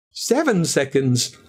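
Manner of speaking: plain reading voice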